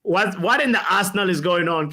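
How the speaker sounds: ordinary talk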